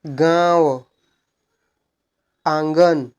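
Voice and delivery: गाँव, आंगन drawn out through nose and mouth; nasalised vowels. Shown as a demonstration. Teacher giving chandrabindu examples, गाँव, आंगन with nasal vowels. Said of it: "नाक और मुंह दोनों से भनभनाहट".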